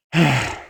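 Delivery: strained, pushing sigh; a heavy straining sigh; constipation sigh. Shown as a demonstration. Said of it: "like constipation sigh".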